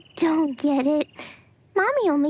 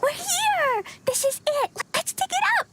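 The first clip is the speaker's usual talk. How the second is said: bright eager calls, pitched way up; bursting with excitement to start digging; excited; 9 semitones higher, faster than usual. Acted. Child arriving eagerly to dig.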